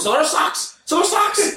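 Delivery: high squealing mock boss; hammed-up boss in a high squeal; frantic boss. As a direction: squeal it like a coked-up manager. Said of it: "She goes".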